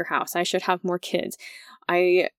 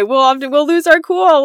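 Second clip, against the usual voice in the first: normal talking voice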